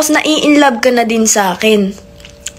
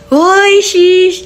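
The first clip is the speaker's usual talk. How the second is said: much higher, louder teasing squeal; excited sheesh; 7 semitones higher, 3 dB louder than usual. Real, not acted. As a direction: squeal it like you can't handle the kilig. Reacts to the imagined kiss. (kinikilig na tili, mas mataas at malakas)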